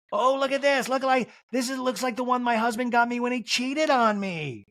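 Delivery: high, put-on showy voice; Kyle. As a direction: exclaim it all high, as a joke. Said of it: "And Kyle's like".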